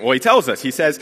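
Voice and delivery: normal talking voice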